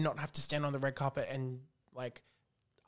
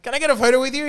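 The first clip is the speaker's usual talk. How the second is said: eager fan ask; 9 semitones higher, 11 dB louder, faster than usual. Retold or imitated. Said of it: "and I was like".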